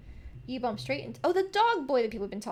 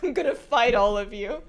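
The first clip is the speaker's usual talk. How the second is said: loud threat through laughter; playfully threaten, cracking up; laughing mock threat; 10 dB louder than usual.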